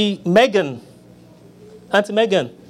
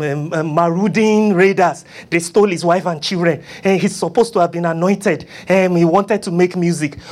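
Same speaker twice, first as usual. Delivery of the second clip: ordinary delivery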